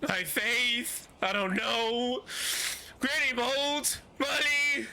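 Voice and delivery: high, slow, blubbering sobs; crying wail. Acted. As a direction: sob it out, overdone. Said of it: "He's like".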